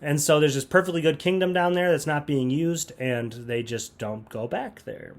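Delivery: normal talking voice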